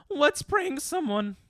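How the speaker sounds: high, slow put-on silly voice; drawn-out, high-pitched mock enthusiasm; mock childish eagerness. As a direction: pipe it up like an overeager prankster. Mockingly acts out the childish suggestion.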